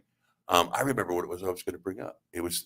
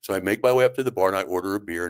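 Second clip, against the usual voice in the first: ordinary talk, nothing marked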